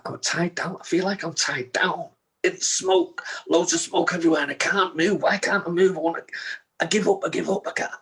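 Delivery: high, urgent re-enacted panic; desperate, rising plea; panicked. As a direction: relive being trapped, pitch climbing and staying high. Retelling panic while trapped in smoke.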